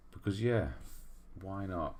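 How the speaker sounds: ordinary talk, nothing marked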